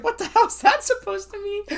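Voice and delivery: high-pitched retold outburst through laughter; imitating an offended comeback, tickled by it; amused mock indignation. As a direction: quote it squeaking up high, cracking up. Retelling funniest line, cracking up.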